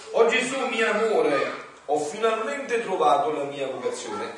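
loud, high ecstatic proclamation; joyful cry. Acted. Saint calls to Jesus on finding a vocation, exultant cry.

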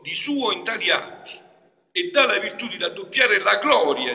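loud, high, impassioned sermon; fervent preaching. Real, not acted. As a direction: preach it out to the congregation. Preaching at full force. (predica appassionata)

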